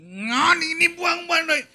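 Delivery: mock-furious wife telling him off; angry wife. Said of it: "Istrinya marah lagi". (istri marah membentak suami)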